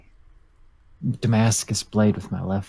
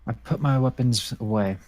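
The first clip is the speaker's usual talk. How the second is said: normal talking voice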